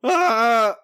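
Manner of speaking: hammed-up Homer scream; mock yelp. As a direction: yelp like you've just been fired. Homer reacting to Burns.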